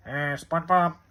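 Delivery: drawn-out cartoon grump voice; slow, put-on cartoon voice; Squidward. Shown as a demonstration. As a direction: slowly groan it out as a cartoon character impression. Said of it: "Do a Squidward voice".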